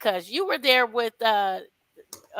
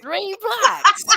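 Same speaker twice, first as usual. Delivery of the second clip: laughing; 8 semitones higher, 9 dB louder than usual. Real, not acted. Repeated, laughing high.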